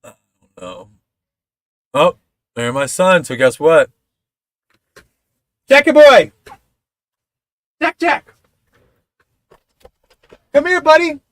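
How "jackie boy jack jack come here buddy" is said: loud high call across the room; father calling his son to come. Real, not acted.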